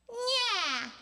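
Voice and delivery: high meowing "nyeah"; cat-like sound. Shown as a demonstration. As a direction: whine it like a cat. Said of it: "kinda like a cat".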